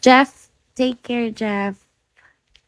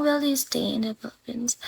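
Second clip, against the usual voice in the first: unvoiced whispered question; whispering a viewer's question aloud; 10 dB quieter than usual. Real, not acted. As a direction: read it under your breath.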